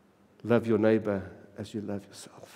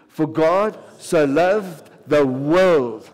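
very slow and louder, every word weighted; booming unison recital; loud slow congregational recital; 2 semitones higher, 7 dB louder, much slower than usual. Shown as a demonstration. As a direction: lead the room in saying it together. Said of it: "can you say it with me?".

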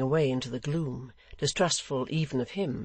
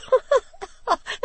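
loud, very high character laugh; loud simpleton's cackle; high gleeful laugh; 7 dB louder than usual. A narrator's reading. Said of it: "Barnaby stopped in his laugh".

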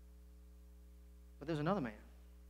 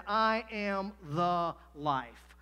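very slow and solemn; 5 dB louder, much slower than usual. Retold or imitated. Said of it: "and he said".

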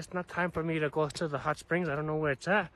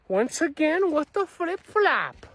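exasperated; 11 semitones higher, 5 dB louder, slower than usual. Real, not acted. Exasperated outburst when nobody answers.

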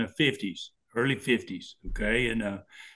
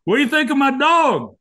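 high loud teasing question, pitched way up; mock proud owner; 11 semitones higher, 7 dB louder than usual. Dad teasing the drunk at the red light, high mock pride. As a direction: show off the bear like a proud dog owner.

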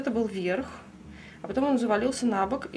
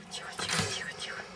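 soothing whisper; 6 dB quieter, faster than usual. Real, not acted. Calming the angry lizard.

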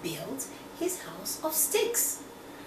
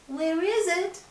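high eager pig; little pig voice; 9 semitones higher, 5 dB louder than usual. Acted. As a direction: squeak the question. Pig asks.

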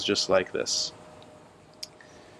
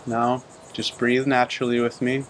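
calm, even meditation voice with long pauses; unhurried phrases, level in pitch, leaving long silences between them; guided meditation; 7 dB quieter than usual. Real, not acted. Teacher guiding listeners to breathe and turn within, calm meditation delivery.

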